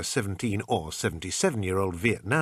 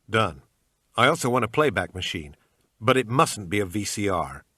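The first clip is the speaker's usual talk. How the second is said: bad American accent; 4 dB louder than usual. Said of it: "in my usual bad American accent".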